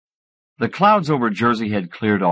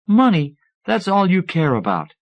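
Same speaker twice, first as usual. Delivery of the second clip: scornful; 8 semitones higher than usual. A narrator's reading. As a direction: throw the word back at him in disbelief. Sandra scolds Joe about money.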